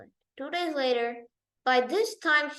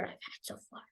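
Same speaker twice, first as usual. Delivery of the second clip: hushed, unvoiced aside; whispered aside; 19 dB quieter than usual. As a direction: whisper it so he won't hear. Wicker worker aside.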